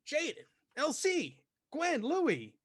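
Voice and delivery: high, slow welcoming host voice; high greeting voice. Acted. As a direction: call each name out high and bright. GM voices man greeting party.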